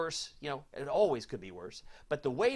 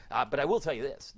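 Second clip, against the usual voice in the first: usual speaking voice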